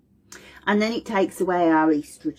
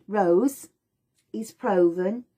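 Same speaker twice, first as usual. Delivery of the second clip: ordinary talk, nothing marked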